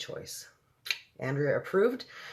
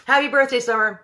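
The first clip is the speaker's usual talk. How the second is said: loud, high celebratory call; excited birthday shout-out; 9 semitones higher, 9 dB louder than usual. Real, not acted. Aunt wishing niece happy birthday, excited shout.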